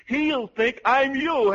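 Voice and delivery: ordinary talk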